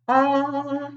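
ordinary talk

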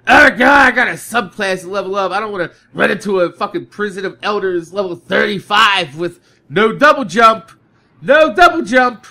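loud mock complaining gamer; loud exaggerated gamer whining; mock whine. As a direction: whine it like a dreading player.

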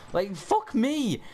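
high, loud, drawn-out rage; real gamer rage yell; furious outburst. Real, not acted. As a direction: blow up at the blown play. Rage at lost fight.

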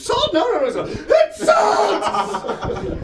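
high, loud laughing protest; panicked cry about salt; frantic shout. Retold or imitated. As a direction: yell it in a panic. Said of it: "he's like".

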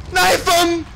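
very high, loud shout; shrieked battle cry; frantic yell. Real, not acted. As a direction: scream the order mid-fight. Yelling during fight.

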